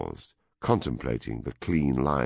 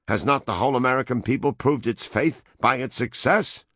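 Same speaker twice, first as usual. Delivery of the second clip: proud American; 8 semitones higher, 5 dB louder than usual. A narrator's reading. World president boasts of his faith, loud and high. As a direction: proclaim your creed like a zealot.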